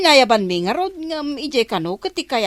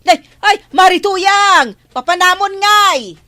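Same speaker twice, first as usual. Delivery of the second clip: loud call; 9 semitones higher, 9 dB louder than usual. Acted. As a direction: yell her name down the road. Calling out to passing neighbor. (napigsa ken nangato nga ayab iti nagan)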